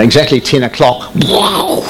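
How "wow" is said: high, astonished wow; surprised wow. Retold or imitated. Watching the jets roar over the site, high astonished wow. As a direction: react to sudden roaring jets.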